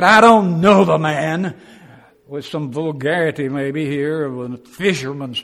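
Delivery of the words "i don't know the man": cursing denial. Acted.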